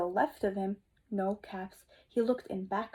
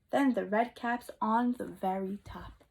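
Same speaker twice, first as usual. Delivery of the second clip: ordinary delivery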